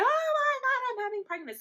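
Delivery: very high loud squeal; imitated ecstatic mom. Mom hearing of pregnancy, imitated high squeal. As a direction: squeal as if overjoyed at the news. Mom's reaction to pregnancy, acted.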